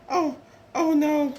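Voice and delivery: a touch of storybook alarm; nervous. Acted. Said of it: "look nervous".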